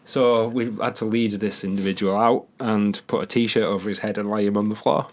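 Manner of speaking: ordinary delivery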